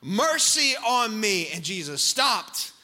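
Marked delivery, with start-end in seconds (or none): 0.0-1.5 loud, high, desperate cry, slowing at the end; shouting louder. Acted. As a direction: cry out with everything. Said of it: "He shouted all the more".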